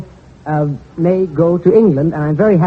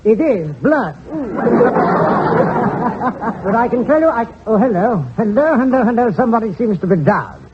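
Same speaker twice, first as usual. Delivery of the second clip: higher, excited British ringside commentary; English boxing commentator; 3 semitones higher than usual. Acted. Comedian sketches an English fight broadcaster, in British accent. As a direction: call the fight like a posh English announcer. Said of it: "thumbnail sketch of an Englishman doing a prize fight".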